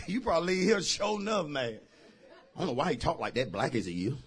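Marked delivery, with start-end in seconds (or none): 2.6-4.1 low, soft imitation of a complainer; mock complaint under the voice; grumbling church member. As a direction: lightly voice a congregant's quiet grumble. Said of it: "I know some of you say".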